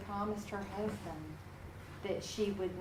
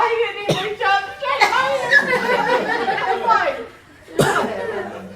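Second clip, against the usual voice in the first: much higher, loud and laughing; incredulous laughing; 13 semitones higher, 15 dB louder than usual. Real, not acted. Teacher laughing to students as the surgeon ignores her hand. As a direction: laugh off the snub in disbelief. Laughing at the rude handshake snub.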